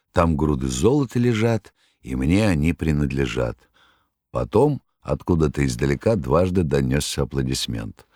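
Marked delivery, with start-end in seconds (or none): none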